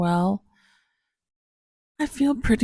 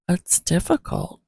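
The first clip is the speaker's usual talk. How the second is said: normal talking voice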